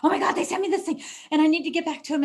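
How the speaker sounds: mock panic over an email; a touch of hammed-up panic; panicked. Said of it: "I'm panicked".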